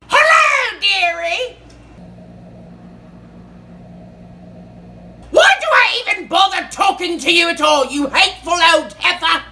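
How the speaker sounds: mock greeting shout. Two old rivals trade insults, loud mock-sweet shouting. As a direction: sing out the greeting, then yell the insult. Heated exchange between characters.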